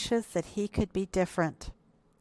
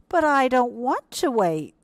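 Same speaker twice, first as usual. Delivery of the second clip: put-on louder, higher childlike protest; impatient little hippo; 6 semitones higher, 5 dB louder than usual. A narrator's reading. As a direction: object like an impatient little one.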